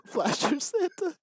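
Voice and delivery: high, slow, cracking into laughter; laughing. Real, not acted. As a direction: say it while breaking up laughing. Laughing at story character.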